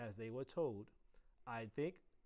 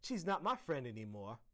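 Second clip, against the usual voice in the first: ordinary delivery, nothing marked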